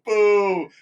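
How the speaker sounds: loud, high mock booing; crowd boo. Crowd booing the candidate, loud and high. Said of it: "half the people go".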